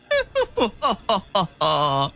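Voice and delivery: gloating villain chuckle; theatrical evil laugh, falling in pitch; Frieza. Acted. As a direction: laugh mockingly at a trapped rival. Villain gloats over trapped rival.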